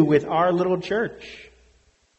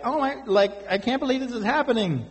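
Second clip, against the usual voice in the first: higher, fast, mock-panicky babble; hammed-up frantic disbelief; 7 semitones higher, faster than usual. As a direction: play a flustered, panicking man. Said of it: "running in panic like".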